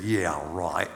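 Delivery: low, doubtful Aussie yeah right; a sceptical Australian scoff, dropped low; buddy doubts the farmer in mock Australian accent. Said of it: "I said".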